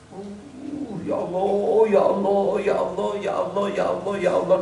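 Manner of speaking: soft, wailing repeated "ya Allah"; mock weeping prayer. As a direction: imitate a showy tearful lament. Mock crying prayer of hypocrite.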